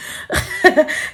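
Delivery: short airy laugh at herself; breathy laugh. Real, not acted. Laughing at her own naivety with viewers. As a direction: laugh off the embarrassment.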